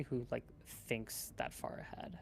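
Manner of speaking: whispered, slow and soft; near whisper. Real, not acted. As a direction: trail off into a whisper. Trailing self-deprecation.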